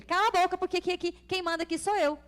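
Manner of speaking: mild mock yell laying down who's boss; retold yelling. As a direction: snap at your son like an exasperated mother. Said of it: "Eu gritei com ele".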